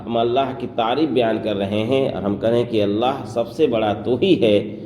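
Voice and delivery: usual speaking voice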